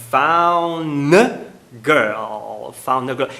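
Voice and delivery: put-on found girl run together, D dropped; linked. Shown as a demonstration. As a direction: glide found into girl without the D. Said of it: "ligação fluida".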